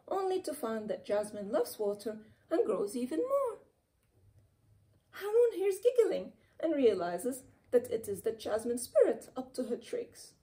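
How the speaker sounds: plain reading voice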